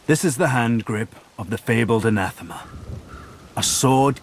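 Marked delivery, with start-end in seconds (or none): none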